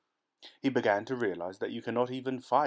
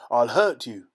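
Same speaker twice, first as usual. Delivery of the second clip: loud, emotional threat; sobbing threat; 3 semitones higher, 10 dB louder than usual. Acted. Nunez threatens the villagers, overcome with emotion. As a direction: let the threat break with emotion. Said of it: "sobbing with emotion".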